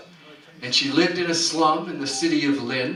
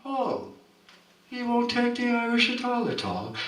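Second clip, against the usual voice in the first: high, softer lilting lament; plaintive lament; 8 semitones higher, 4 dB quieter than usual. Acted. Peggotty lamenting to the officer in a high lilt. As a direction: lament it in a lilting Irish tune.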